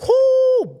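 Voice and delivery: sudden high whooping cry; loud whoo. Real, not acted. Outburst of anger mid story.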